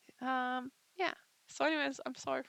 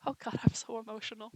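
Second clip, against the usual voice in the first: usual speaking voice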